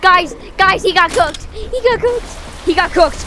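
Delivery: loud, high excited repeats; excited shouting. Real, not acted. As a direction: shout the news to friends.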